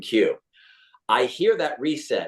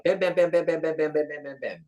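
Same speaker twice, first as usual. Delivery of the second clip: rapid, even patter fading away; quick-fire dialogue; 5 semitones higher, 4 dB quieter than usual. Shown as a demonstration. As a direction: rattle it off like volleyed lines, trailing down. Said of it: "lots of quick dialogue".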